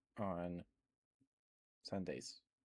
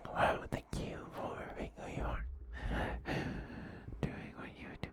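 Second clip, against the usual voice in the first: whispering to the dog; 13 semitones lower than usual. Real, not acted. Whispering praise to the good boy dog. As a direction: murmur kind words to the pet.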